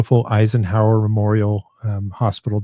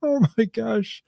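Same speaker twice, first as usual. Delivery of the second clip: high, breathless oh my gosh through laughter; laughing in disbelief at a clip; 10 semitones higher, 6 dB quieter, slower than usual. Real, not acted. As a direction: gasp it out mid-laugh.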